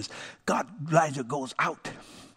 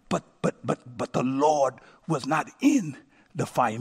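usual speaking voice

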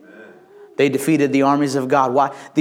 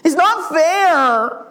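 put-on high, stretched-out childish whine; hammed-up kiddie complaint; whiny kid; 11 semitones higher, much slower than usual. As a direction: protest like a sulking child, dragging the words. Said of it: "You know how kids do".

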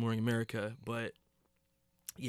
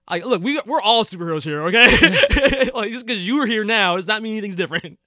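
louder, higher mock retort broken by laughter; laughing retort; 6 semitones higher, 7 dB louder than usual. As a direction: argue back through giggles. Voices character's retort while laughing.

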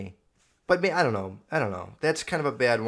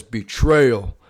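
loud, booming game-announcer imitation; punchy imitated announcer callout; Halo game voice; 2 semitones lower, 7 dB louder than usual. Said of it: "When he says".

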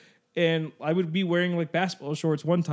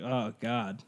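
low, soft retold groan of dismay; 7 semitones lower, 7 dB quieter than usual. Man retelling his height being measured, dismayed. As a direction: sink into a deflated aside.

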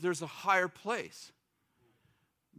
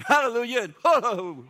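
loud, far-off shouted hallelujah; Pentecostal shouts; 5 semitones higher, 10 dB louder than usual. Retold or imitated. Old Pentecostal crowd reacting, loud shouted praise.

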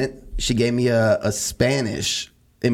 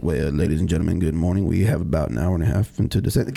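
lightly imitated low intercom voice; a hint of a pilot on the PA; pilot announcement; 7 semitones lower than usual. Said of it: "[name]'s beautiful voice on the intercom".

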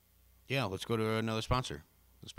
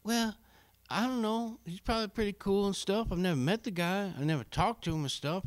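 high goofy Marcus voice; 10 semitones higher than usual. Acted. Marcus character, high put-on voice.

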